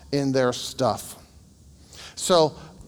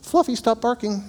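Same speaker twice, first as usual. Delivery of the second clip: higher-pitched lady scolding her dog; scolding dog owner; 7 semitones higher than usual. Retold or imitated. Dog owner scolding her yappy dog, lightly voiced. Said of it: "the lady would go".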